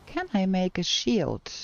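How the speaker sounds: ordinary delivery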